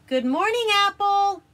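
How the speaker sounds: high, loud calling out. Acted. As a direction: call out to wake him. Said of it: "he cried".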